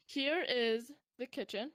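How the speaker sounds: ordinary talk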